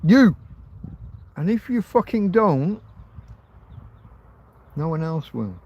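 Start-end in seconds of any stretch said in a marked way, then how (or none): 0.0-2.8 higher, louder, jabbing at the viewers; an angry challenge; ranting shout. Real, not acted. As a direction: drive the point home hard.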